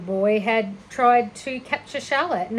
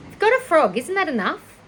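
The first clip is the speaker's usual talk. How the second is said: put-on scolding girl; 7 semitones higher, 5 dB louder than usual. Fern scolds Avery.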